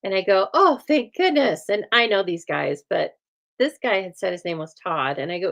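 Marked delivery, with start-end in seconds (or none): 0.5-1.7 high, drawn-out retold relief; re-enacted gush of relief; relieved exclamation. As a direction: act out relief, pitch leaping up slowly. Acts out relief at arriving.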